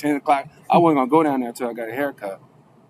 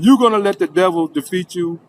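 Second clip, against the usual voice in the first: raised, louder, pressing challenge; exhorting; 6 semitones higher, 6 dB louder than usual. Retold or imitated. Officer urging a wavering defendant to trust God, exhorting. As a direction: challenge them like an exhorting mentor.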